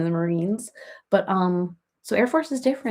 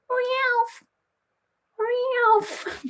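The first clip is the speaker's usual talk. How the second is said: very high meowing Ralph; cat calling Ralph. Pretend. The cat calling nephew Ralphie, very high meowing. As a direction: meow his name like the cat. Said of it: "She'll go".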